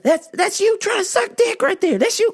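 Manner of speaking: jeering, squeaky high voice; shrill-pitched taunt; high-pitched mockery. Real, not acted. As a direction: jeer at the suck-up way up high. Mocking suck-ups over his babble.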